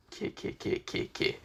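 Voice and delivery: breathless, voiceless villain's snicker; evil snicker. Acted. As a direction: snicker under your breath like a schemer. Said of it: "smiles in a very vicious".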